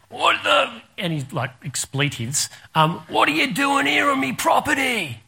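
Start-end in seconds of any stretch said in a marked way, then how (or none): 0.1-0.8 high-pitched outraged exclamation, imitated; angry farmer's voice. Unseen driver challenges trespassers, indignant high yell. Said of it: "All we heard was a voice goes". 3.1-5.2 high, strained shout; imitated angry farmer. Farmer catches armed trespassers on his land, outraged high-pitched yell. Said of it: "Like that".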